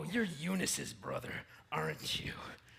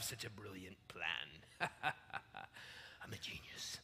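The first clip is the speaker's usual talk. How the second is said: gloating whisper; 10 semitones lower, 14 dB quieter, much slower than usual. Acted. Gloating to himself.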